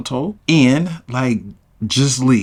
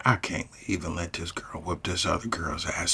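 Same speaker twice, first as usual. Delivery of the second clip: whispered aside to himself; 7 semitones lower, 13 dB quieter than usual. Retold or imitated. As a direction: breathe it under your breath. Thinking to himself mid-fight.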